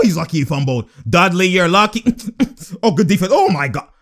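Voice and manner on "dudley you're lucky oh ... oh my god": normal talking voice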